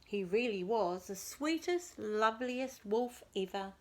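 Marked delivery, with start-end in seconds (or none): none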